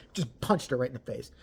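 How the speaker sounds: ordinary delivery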